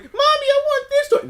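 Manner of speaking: high-pitched mock child asking mom for a toy; whiny little kid. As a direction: beg like a kid at the store. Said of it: "you're like a kid at the store".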